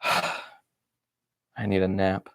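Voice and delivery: drained, sleepy mutter; exhausted sigh. Acted. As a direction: sigh it out like you're done for the day. Tired end of diary entry.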